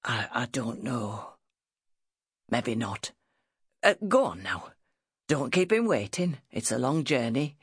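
low, halting northern father; husky ailing father. Acted.

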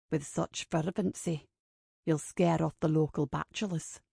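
low, soft murmur of a teasing man; murmuring man's voice. A narrator's reading. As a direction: drop low and soft, just for one listener. Kincaid murmurs teasingly to Ava.

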